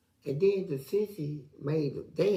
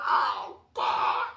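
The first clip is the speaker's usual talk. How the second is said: high cry of joy breaking to breath; joyful cry; 8 semitones higher than usual. Retold or imitated. Hearing he'll be a father, a high cry of joy. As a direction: gasp it out overjoyed. Overjoyed at the news.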